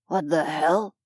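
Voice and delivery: a hint of a rough thug, uneasy; mildly gravelly confused thug; gravelly goon. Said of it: "asked the gravelly-voiced goon".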